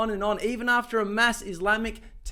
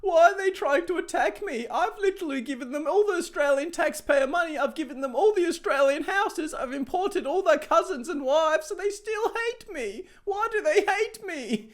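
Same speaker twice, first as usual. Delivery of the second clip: much higher, whining mock Albanese; hammed-up wounded politician voice; mock whiny Albo; 11 semitones higher than usual. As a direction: whine like a baffled, self-pitying leader. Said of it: "He's like".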